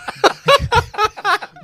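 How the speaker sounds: high laugh bursting out; burst of laughter. Real, not acted.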